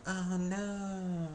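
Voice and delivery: high, shocked boss hearing the story; boss's dismay. Retold or imitated. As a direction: react like a worried boss. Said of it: "my boss is just like".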